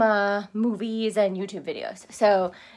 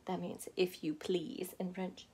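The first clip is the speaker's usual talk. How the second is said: whispered aside; 4 semitones lower, 12 dB quieter than usual. Real, not acted. Translation aside.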